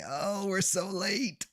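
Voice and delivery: slow, high, put-on whine about being late; high sing-song lament. Streamer to chat, moaning about being late in a high slow sing-song.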